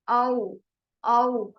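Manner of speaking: "औ" as one gliding sound; diphthong demo. Shown as a demonstration. Teacher showing students the sound, "औ" as a glide. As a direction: say "औ" on its own, gliding between two vowels. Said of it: "ये वाली जो हमारे पास sound थी".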